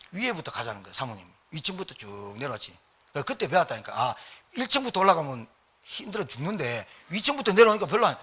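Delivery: ordinary delivery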